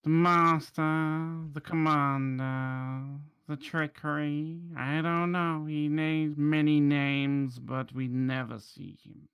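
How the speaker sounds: slow, drawn-out shopkeeper voice; lightly voiced evasive informant, words stretched; wary shopkeeper. Shopkeeper telling party about the master, slow and wary.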